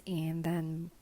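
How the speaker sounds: usual speaking voice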